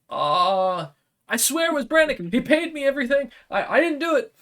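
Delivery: high squeaky panicked imp; frightened imp voice. Acted. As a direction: squeal it out, begging for your life. Imp pleading in fear.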